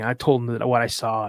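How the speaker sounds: ordinary talk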